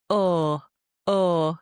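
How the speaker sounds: "Oh" said with a Yorkshire goat vowel, a long pure rounded o. Shown as a demonstration. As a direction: stretch "Oh" into a flat Yorkshire oh sound. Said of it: "one vowel that's more like".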